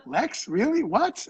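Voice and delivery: high-pitched, incredulous questioning; retold disbelief, voice jumping up on each question; surprised reaction. Reacting to a friend's unrecognized voice on the phone, surprised. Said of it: "When you called me on the phone, I didn't even know who it was".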